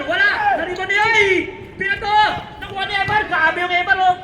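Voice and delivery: excited basketball commentary; live play-by-play. Real, not acted. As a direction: courtside announcer in full flow.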